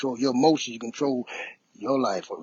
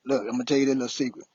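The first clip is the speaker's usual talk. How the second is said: ordinary talk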